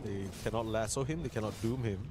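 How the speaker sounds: normal talking voice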